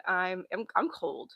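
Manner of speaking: ordinary talk, nothing marked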